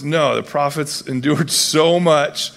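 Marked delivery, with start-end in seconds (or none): none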